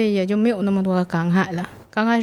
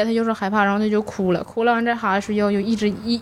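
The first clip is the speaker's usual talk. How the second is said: ordinary delivery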